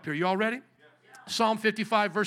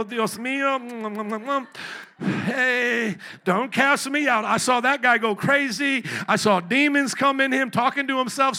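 a hint of a desperate David begging God, louder; pleading David; 4 semitones higher, 7 dB louder, slower than usual. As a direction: beg God not to cast you out. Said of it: "David's like".

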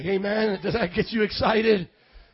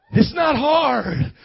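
loud, slow and raised, leaning on HARD; emphatic preaching rebuttal; 5 semitones higher, 10 dB louder, much slower than usual. Real, not acted. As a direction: firmly knock down the complaint. Preacher rebuts the mock complaint.

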